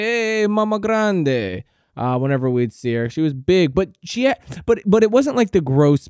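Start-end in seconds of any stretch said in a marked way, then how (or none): 0.0-1.6 family greeting. Retold or imitated. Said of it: "They say".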